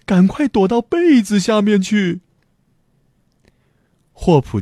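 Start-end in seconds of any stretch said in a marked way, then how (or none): 0.1-2.2 high, mock-tearful urging; mild storybook wail; tearful shout. A narrator's reading. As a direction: call out as if close to crying. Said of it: "一群人带着哭腔喊".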